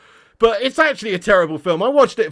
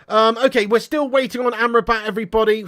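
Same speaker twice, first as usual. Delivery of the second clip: ordinary talk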